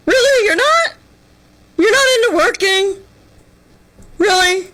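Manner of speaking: mock disbelief. Mocking young people who won't work, high fake shock. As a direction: feign shock at not wanting to work. Sarcastic reply to Gen Z.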